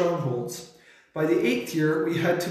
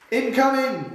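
high-pitched battlefield call; warning yell; 8 semitones higher, 3 dB louder than usual. A narrator's reading. Heard while the colonel charges from the ditch, high warning yell. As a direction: call out the danger to the troops. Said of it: "we heard".

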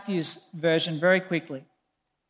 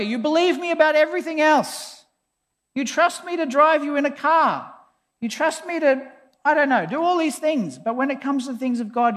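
raised pitch, exasperated pleading; frustrated appeal; 6 semitones higher than usual. Retold or imitated. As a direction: argue your honesty to someone who won't listen. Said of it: "It's so frustrating".

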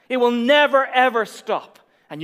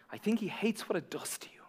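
breathy whisper, no voice; 13 dB quieter than usual. Real, not acted. Pastor whispering to the congregation what worry does. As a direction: lean in and whisper it.

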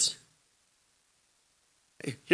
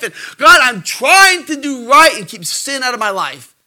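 pleading prayer voice, building louder; mock prayer; 5 semitones higher, 4 dB louder than usual. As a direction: pray it earnestly, rising to a cry.

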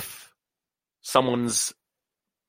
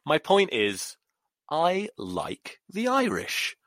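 normal talking voice

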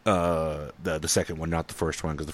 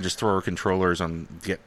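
usual speaking voice

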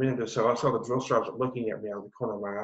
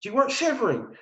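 high-pitched imitation of a sergeant; drill sergeant; 12 semitones higher than usual. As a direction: challenge the recruit in a put-on sergeant voice. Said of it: "Man laughed so hard".

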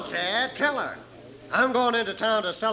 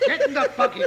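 laughing, higher bark; 11 semitones higher, faster than usual. Acted. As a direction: laugh it off gruffly. Villain laughs off his beating.

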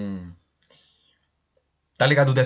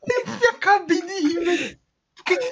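rindo; 3 dB louder than usual. Real, not acted. Conta que riu muito.

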